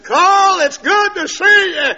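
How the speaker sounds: high, loud, drawn-out welcome; hollered greeting. Retold or imitated. Preacher meeting the man home from hospital, hollering. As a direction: call it out big across the yard.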